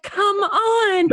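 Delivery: high-pitched exasperated cry; exasperated mock shout. Crew member urging the lighting person, exasperated mock cry. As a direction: plead in mock exasperation, voice shooting up. Imagining frustration at missed lighting cue.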